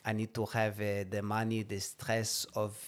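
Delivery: lightly voiced Israeli accent, slow; a hint of an Israeli accent pleading. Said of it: "his little Sabra Yiddish accent".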